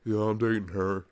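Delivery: mock bro. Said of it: "It's just like".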